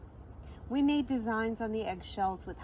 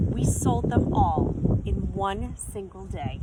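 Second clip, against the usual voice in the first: plain reading voice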